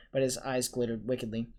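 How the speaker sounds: plain reading voice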